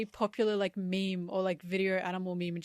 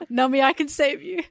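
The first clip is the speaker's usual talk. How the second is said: louder, higher rescue cry; mock heroic call; 6 semitones higher, 9 dB louder than usual. Offering to save Nami from giant bees, mock heroic call. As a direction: call out like a joking hero. Jokey acted rescue line.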